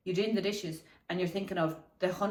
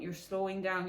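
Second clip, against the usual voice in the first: ordinary talk, nothing marked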